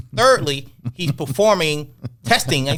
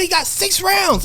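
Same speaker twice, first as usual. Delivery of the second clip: insistent pushing. Retold or imitated. Retold argument, Amir's side.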